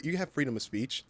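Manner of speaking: usual speaking voice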